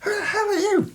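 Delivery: shrill high demand. Acted. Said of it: "the A S M says".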